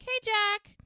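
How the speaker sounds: high, loud hello to an acquaintance; called greeting. Retold or imitated. Charmaine greets Jack on her way in, called greeting.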